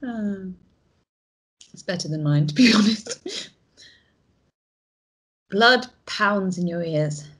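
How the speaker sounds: ordinary talk, nothing marked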